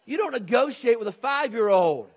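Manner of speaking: loud, high indignant exclamation; emphatic preaching. Real, not acted. Indignant punchline to parents.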